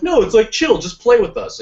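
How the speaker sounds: high, loud breezy coaxing; breezy friend voice. Retold or imitated. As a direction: coax them casually. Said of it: "and they're like".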